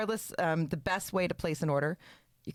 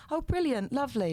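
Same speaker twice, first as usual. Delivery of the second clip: high, chirpy mock British accent; 7 semitones higher than usual. Guest imitating herself among British people, British accent. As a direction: pick up the British accent on the fly. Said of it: "if I'm around British people".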